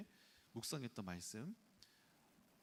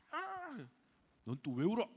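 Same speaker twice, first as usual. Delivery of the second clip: put-on high, loud exasperated yell at a crying child; 9 semitones higher, 5 dB louder, slower than usual. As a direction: snap at the kid who won't stop crying. Dad yelling at crying son.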